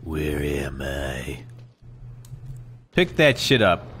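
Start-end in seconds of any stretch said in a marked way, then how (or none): 0.0-1.4 slow, low, bewildered; dazed slow voice. Pretend. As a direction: drag it out dazed. Dazed after escape.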